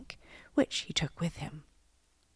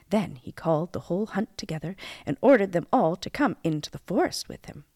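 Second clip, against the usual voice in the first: ordinary delivery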